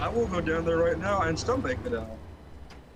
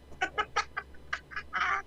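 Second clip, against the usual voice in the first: squeaky breathless giggle; helpless high laughter; high squealing laugh; 7 dB quieter than usual. Real, not acted. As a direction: burst into a high-pitched laugh. Laughing at joke.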